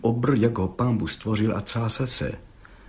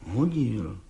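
stubborn mumble; 2 semitones lower, 6 dB quieter than usual. Acted. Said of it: "mumlal Václav Lisický s beraný tvrdohlavostí".

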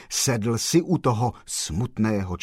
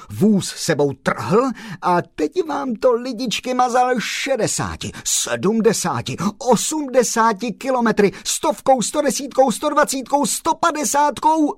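rising higher and louder with speed; building excitement; 12 semitones higher, 4 dB louder than usual. A narrator's reading. Narrator tells listeners of the wild ride, building excitement. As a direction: build the thrill as the car races.

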